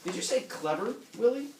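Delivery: high, bright-pitched wife voice; wife on the phone. Acted. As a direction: call him like a sharp wife on the phone. Reading Helen's phone line aloud.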